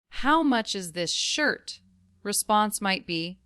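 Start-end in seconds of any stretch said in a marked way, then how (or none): 0.1-1.8 "shirt" lifted at the end as a question; rising question tone. Shown as a demonstration. Customer holding a shirt asks the price, rising question tone. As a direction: let the pitch climb on "shirt" to ask. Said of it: "Say it with a rising tone at the end".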